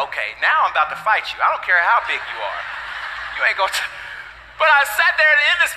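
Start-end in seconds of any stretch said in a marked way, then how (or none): 0.1-3.8 lower, mock-tough threat; lightly voiced tough-guy dad; mock fighting dad. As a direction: playfully threaten to fight the baby. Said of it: "I was like".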